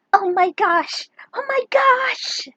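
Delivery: high excited cry, then breathless whisper; overjoyed gasp. Acted. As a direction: burst out, then hush in disbelief. Mother sees her lost son.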